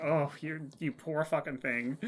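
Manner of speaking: soft, sympathetic mock reply; pitying aside. Viewer to waiter on his first day, soft pity.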